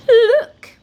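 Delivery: very high, loud shriek of warning; horrified cry. A narrator's reading. Wizard points at the creatures, horrified cry. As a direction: shriek it in horror. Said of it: "gulped and pointed at the creatures".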